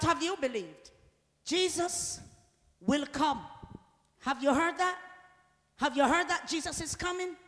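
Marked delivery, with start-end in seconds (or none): none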